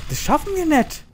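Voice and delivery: pitched far up, wailing; high despairing wail. Real, not acted. Repeats the line differently.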